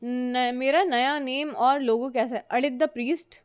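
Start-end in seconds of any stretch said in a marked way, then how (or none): none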